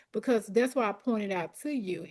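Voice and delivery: ordinary talk, nothing marked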